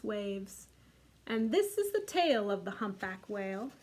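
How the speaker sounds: lively storybook read-aloud; reading to children. A narrator's reading. Teacher reading The Snail and the Whale to kids. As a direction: read it warmly to a group of little ones. Reads picture book aloud.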